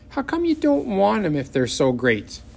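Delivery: doubt the gift; suspicious child. A narrator's reading. Little sister doubting the paper dolls, high suspicious.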